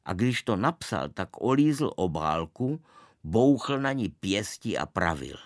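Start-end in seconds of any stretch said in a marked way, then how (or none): none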